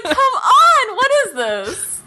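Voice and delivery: high, loud exasperated outburst. Retold or imitated. Reacting to the shonen speech, loud high exasperation. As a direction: protest the cliché in mock disbelief.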